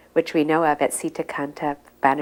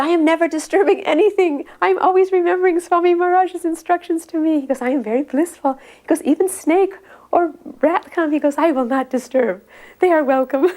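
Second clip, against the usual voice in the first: put-on much higher, louder quoted old man; old man's voice; 11 semitones higher, 5 dB louder than usual. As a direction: play the old man, content and proud. Old man quoted about cobwebs.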